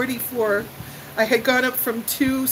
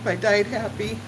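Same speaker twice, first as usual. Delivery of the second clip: ordinary talk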